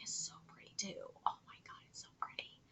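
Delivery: hushed whisper of admiration; fading to a near-silent whisper; awed whisper. Real, not acted. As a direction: breathe it out in wonder.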